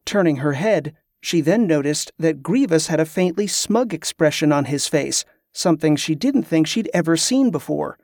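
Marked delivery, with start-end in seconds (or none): none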